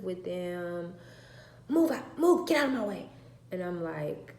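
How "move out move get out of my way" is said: loud, higher barked orders; aggressive shove. Retold or imitated. Teen daughter shoving her siblings aside, aggressive. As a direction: bark at the little ones to move. Daughter pushing kids, voiced.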